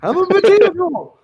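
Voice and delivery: squeaky voice through laughter; high and giggly; laughing high voice. Pretend. Echoing the potato line, laughing.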